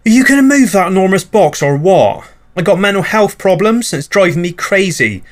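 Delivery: high, put-on nagging neighbour voice; angry neighbour. Neighbour at the door complaining about the box, high nagging voice. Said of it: "your neighbour's peg leg".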